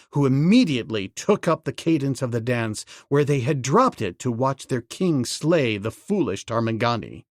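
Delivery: ordinary delivery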